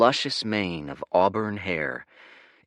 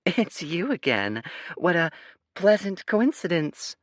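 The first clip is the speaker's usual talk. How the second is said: high, sing-song, put-on sweetness; fake sing-songy voice; 10 semitones higher than usual. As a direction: coo it in a false girly voice. Spy Girl keeps fake voice.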